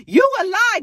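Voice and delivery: very high, loud furious yell; yelling at boyfriend. Retold or imitated. Sierra screaming at BK over the phone, high loud yell. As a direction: scream that he's lying. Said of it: "she yelling and screaming".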